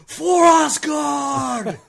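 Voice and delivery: booming slow battle cry; God of Thunder. Acted. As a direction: roar it like a thunder god charging. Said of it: "And the God of Thunder".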